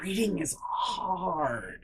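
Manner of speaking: very soft, drawn-out quoted catchphrase; Laundry King. Retold or imitated. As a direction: stretch it out slowly and softly as a mock lament. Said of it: "as the Laundry King has told us all".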